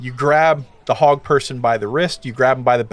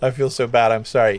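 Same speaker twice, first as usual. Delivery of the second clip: normal talking voice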